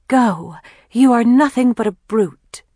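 high, loud melodramatic outburst; dramatic reading aloud. A narrator's reading. Kathleen reads a racy story to the girls, melodramatically. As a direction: declaim it like a racy serial heroine. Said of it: "always reads aloud the latest installment".

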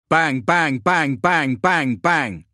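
rapid-fire bangs, high and loud; automatic gunfire. A narrator's reading. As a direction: fire off the bangs in an unbroken staccato burst. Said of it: "fire on full automatic".

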